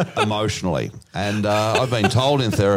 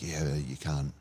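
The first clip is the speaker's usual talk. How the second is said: steward's mild reprimand; 5 semitones lower than usual. Retold or imitated. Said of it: "and he's like".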